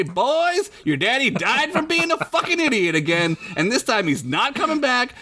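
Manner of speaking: high-pitched exasperated mom; scolding mama. Acted. Mama telling her boys about daddy, high and exasperated. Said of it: "it'll make your mama say".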